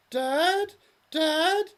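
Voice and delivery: very high calling voice from heaven; high ethereal plea; ghostly daughter. Acted. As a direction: call down from the clouds.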